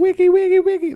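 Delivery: high-pitched vocal turntable scratches; squeaky scratch noises made by mouth; record scratching. Acted. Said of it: "That was some scratching there".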